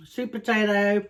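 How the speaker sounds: normal talking voice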